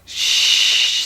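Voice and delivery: unvoiced hushing shh; Daddy shushing his shouting kid. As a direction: hush her at once. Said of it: "said Daddy".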